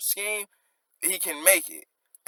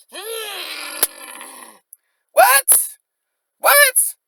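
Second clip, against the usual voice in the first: squealed high, loud what; stunned reaction; 4 dB louder, much slower than usual. Real, not acted. Reacting to the bar in disbelief, squealed high what. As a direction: react like the bar hit hard.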